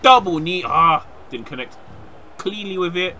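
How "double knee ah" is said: loud, high move call ending in a cry; shouted move call. Real, not acted. Gamer calling a double knee that misses, loud shout. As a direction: shout the attack, then yelp.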